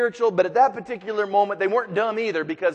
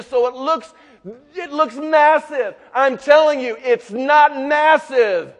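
higher, louder preaching build; preaching at full force; 6 semitones higher, 5 dB louder than usual. Acted. Preacher urging the congregation not to fear, full force preaching. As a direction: drive it home to the congregation.

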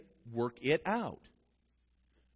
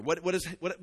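usual speaking voice

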